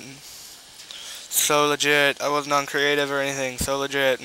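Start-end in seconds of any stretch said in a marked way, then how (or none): 0.0-2.2 slow drawn out. Acted. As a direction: stretch each word out slowly. Drawn-out repeat of legit.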